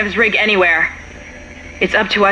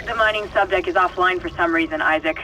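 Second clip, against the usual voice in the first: over the radio; 6 dB quieter than usual. Acted. Radio transmission to Isaac.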